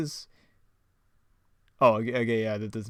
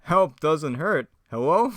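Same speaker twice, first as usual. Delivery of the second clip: slow, raised, louder cartoon voice; calling out in character; 5 semitones higher, 7 dB louder, slower than usual. Gang member seeking help, calling out louder and higher. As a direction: stretch it out and call hello. Acted script line, calling out.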